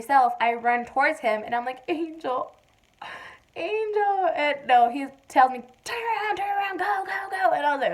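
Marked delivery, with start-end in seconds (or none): 1.9-4.3 high, lovey-dovey call of a name. Retold or imitated. Wife running towards her husband, playful high calling out. 5.8-7.5 high-pitched mock shout from afar; urgent calling out. Husband telling wife to turn around, urgent mock calling out.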